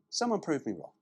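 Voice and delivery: ordinary talk, nothing marked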